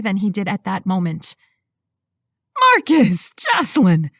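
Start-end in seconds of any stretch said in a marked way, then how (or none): 2.7-4.1 loud, slight Texan greeting; Texan accent. A narrator's reading. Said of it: "in her slight Texan accent".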